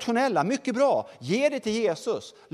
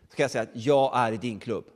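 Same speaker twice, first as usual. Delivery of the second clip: ordinary delivery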